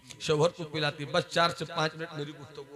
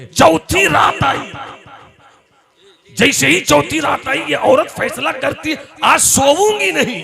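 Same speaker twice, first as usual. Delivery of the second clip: climactic proclamation; 13 semitones higher, 16 dB louder than usual. A narrator's reading. Storyteller announcing the fateful night, high and loud. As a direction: cry it out to the whole hall.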